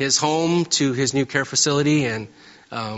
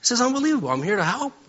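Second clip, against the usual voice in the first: much higher, exasperated outburst; retold upset; 3 semitones higher than usual. Complaining to his wife about doing the move alone, much higher and exasperated. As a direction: vent it like a man at the end of his rope. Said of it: "I was upset".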